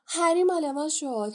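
the twins cheer as Harry joins their house. A narrator's reading. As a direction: yell it in triumph over the crowd.

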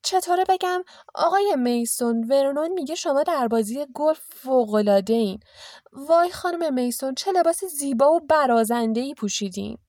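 high, fawning hostess voice; gushing aunt. A narrator's reading. Petunia rehearsing compliments for the Masons, gushing. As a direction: flatter them with exaggerated admiration.

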